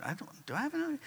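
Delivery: high, soft, puzzled question; half-voiced confusion, pitched high; puzzled surprise. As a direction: sound baffled, thrown off. Confused at the invented meeting.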